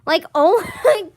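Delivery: talking through laughter; laughing. Real, not acted. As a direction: giggle through the words. Laughing while retelling.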